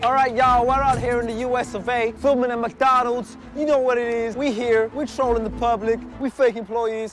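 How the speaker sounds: loud, hyped-up fake American; big mock American vlogger intro; put-on American accent. Prankster introducing his McDonald's challenge, in a fake American accent. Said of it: "don't do an accent".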